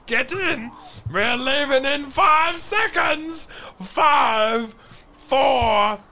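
high, drawn-out departure call. Acted. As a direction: holler a last warning to stragglers. Calling out an imminent departure.

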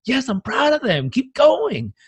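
high, bright cheering on a friend; voicing your best self cheering a friend's success; supportive cheer. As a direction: cheer them on like a proud friend. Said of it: "you're on the other side and you're like".

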